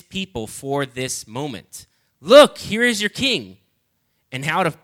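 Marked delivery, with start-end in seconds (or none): 2.2-3.6 proclaiming. Acted. Preacher proclaims Pilate's words.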